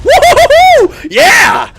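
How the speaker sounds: high, loud whooping cheer; victory whoop. Real, not acted. Gamer whooping loud after scoring a kill. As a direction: let it rip after the kill.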